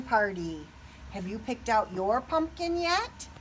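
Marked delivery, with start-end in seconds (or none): none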